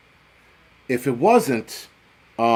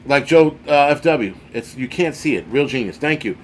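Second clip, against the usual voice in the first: ordinary talk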